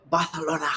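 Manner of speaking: "Barcelona" quick with a lisped th. Acted. Correcting a girlfriend about the cruise port, lisped Barcelona. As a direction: say "Barcelona" Spanish-style, th for the c. Showing off Spanish lisped pronunciation.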